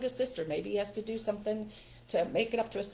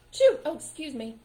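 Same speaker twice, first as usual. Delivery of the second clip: a fake sneeze, higher and louder, then a quick excuse me; mock sneeze; 6 semitones higher, 8 dB louder than usual. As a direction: ham up a sneeze and apologize.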